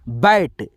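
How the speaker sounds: बैट with a short open a; bat. Shown as a demonstration. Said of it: "ए का उच्चारण अ में आएगा".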